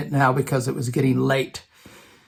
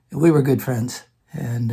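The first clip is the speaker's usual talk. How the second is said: ordinary talk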